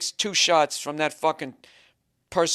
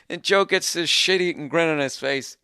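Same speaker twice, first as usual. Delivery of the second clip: usual speaking voice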